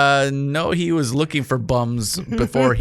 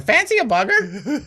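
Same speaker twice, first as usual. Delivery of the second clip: much higher squawky parrot line; 11 semitones higher than usual. Retold or imitated. Parrot calling from the background, high squawky voice. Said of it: "Parrots in the background going".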